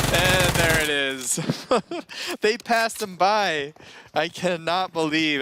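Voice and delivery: high, loud, drawn-out amazement; laughing disbelief. Real, not acted. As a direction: stretch the words out, incredulous and amused. Laughing as the missed enemy pays off.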